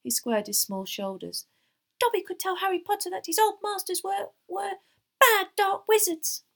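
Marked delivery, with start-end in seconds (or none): 2.0-6.4 high elf voice, slowing and louder at the end; hesitate, then blurt it out squeakily; squeaky house-elf daring himself. A narrator's reading. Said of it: "quivering all over".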